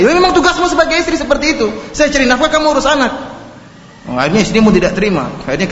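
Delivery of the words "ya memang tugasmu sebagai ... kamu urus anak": much higher, laughing husband's smug retort; husband laughing off his wife's complaint; mocking retort. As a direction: toss it back with a smug laughing edge.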